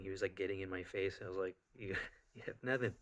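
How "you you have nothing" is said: amused taunt with a laugh breaking in; chuckling, defiant retort; smiling taunt. Retold or imitated. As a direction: laugh in their face as you dismiss them. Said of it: "I was smiling".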